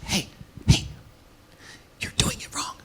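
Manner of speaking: put-on hissed whisper of a scolding girlfriend; angry whisper. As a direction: whisper fight in public, sharp and hushed. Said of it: "now we're in a whisper fight".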